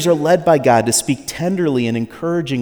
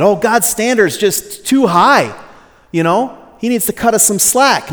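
mock excuse; 7 semitones higher than usual. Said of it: "No, they don't say that".

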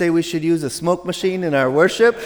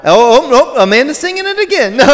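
high, louder, hurried inner groan; mock dismay; 7 semitones higher, 5 dB louder, faster than usual. Churchgoer reacting to yet another repeated chorus, mock dismay. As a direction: act out an exasperated churchgoer, half laughing. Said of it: "you might think to yourself".

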